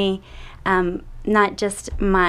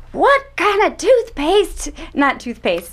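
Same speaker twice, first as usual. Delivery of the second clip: put-on higher, louder Texas accent; 10 semitones higher, 4 dB louder than usual. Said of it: "my Texas accent's coming out".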